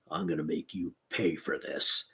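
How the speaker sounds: low, soft threat under the breath; Big Klaus vowing revenge leaving town. A narrator's reading. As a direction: mutter the revenge darkly. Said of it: "Big Klaus cried".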